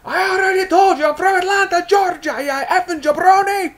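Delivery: loud, very high hammed-up angry yell; Iron Sheik blowing up. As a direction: explode in a mock Iranian wrestler's rant. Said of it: "Sheik blows up at him".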